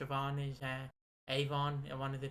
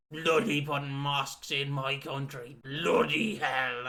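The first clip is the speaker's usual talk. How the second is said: put-on slow, louder grumbling old bloke; grumpy bigot; 6 dB louder, slower than usual. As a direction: drag out a cranky rant. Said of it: "People now just complain".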